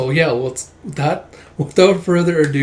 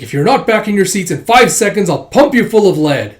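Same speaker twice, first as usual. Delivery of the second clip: raised, loud acted threat; threatening hijacker; 7 semitones higher, 8 dB louder than usual. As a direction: lay down a forceful ultimatum.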